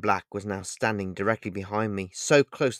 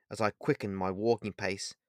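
plain reading voice

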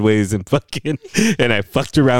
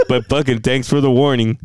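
ordinary talk, nothing marked

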